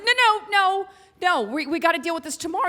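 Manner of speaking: high, loud, rushed refusal; re-enacted impatient protest; urgent insistence. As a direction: cut him off and insist, fast. Retelling her insistence against delay.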